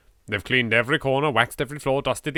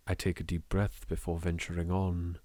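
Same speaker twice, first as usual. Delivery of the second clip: softer and lower, slowing to a hush; ominous fade; 4 semitones lower, 11 dB quieter, slower than usual. Acted. Entering the mansion alone, narrated in a low fading hush. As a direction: let it sink into darkness.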